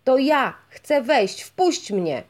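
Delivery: loud, demanding shout at the gate; impatient fisherman shouting at the gate; rude shouting. A narrator's reading. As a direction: yell it, banging to be let in. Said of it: "zawołał rybak".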